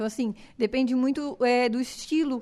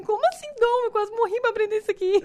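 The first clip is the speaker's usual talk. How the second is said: high, loud, incredulous protest; retold outraged reply, pitched up and louder; indignant disbelief; 9 semitones higher, 4 dB louder than usual. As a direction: bristle at the idea of talent, voice up high. Retelling her reply to doubters.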